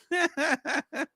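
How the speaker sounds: a high burst of laughter. Real, not acted. Reading the comic, the reader bursts into high laughter. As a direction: crack up at the page.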